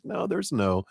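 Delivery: ordinary talk, nothing marked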